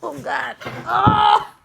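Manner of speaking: pained groan getting up; loud aching cry from stiffness; sore muscles. Real, not acted. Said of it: "I'm so sore".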